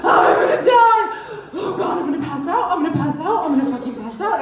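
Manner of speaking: breathless from laughing, high and loud; gasping, winded after yelling; out of breath. Real, not acted. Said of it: "Deep breaths".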